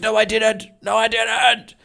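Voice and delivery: high, loud mock protest; yelled denial. Client denying to the agent he gave instructions, yelling. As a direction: imitate a client hotly denying it. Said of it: "they're all yelling at me".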